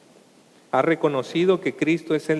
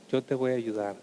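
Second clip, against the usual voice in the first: gentle reassurance; 4 semitones lower, 7 dB quieter than usual. Acted. The Lord reassures.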